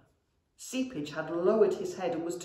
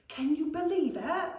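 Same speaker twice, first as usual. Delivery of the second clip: much higher, amazed goblin; awed goblin; 11 semitones higher, 4 dB louder than usual. A narrator's reading. Goblin to brother in amazement, high. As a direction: marvel at it under your breath. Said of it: "hissed Seepage".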